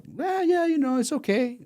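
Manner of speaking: high-pitched mock modesty; acted nonchalance in a high voice; playing it down. Technician playing down his big pay.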